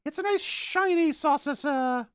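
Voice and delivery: squeaky high, slow and loud; high silly voice. Pretend. Painter showing off his saucer, in a high silly voice. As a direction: coo it like a cartoon. Painter voicing excited aside.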